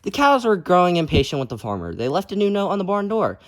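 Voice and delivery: lively, loud storybook reading; reading to children. A narrator's reading. Teacher reading a picture book aloud to kids, lively. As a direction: read it out big for the kids.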